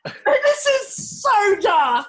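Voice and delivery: laughing loud and high; slowly drawn out through a big laugh. Real, not acted. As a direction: cracks up, shouting it through laughter. Laughing reaction.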